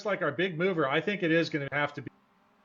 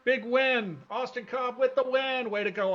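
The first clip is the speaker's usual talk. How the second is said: higher, triumphant winner call; excited call; 8 semitones higher than usual. Real, not acted. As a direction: announce the winner with a lift. Calling the race winner.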